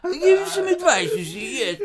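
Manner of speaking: high put-on British accent, laughing; hammed-up British advisor, settling at the end; British man. British man offering the hero advice, mock British accent. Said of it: "British man".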